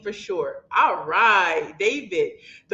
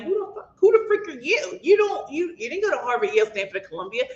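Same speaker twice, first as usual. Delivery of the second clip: high-pitched, sassy mock put-down; exaggerated disbelief in a higher voice; mocking her critics; 6 semitones higher than usual. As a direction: imitate snooty critics sizing someone up.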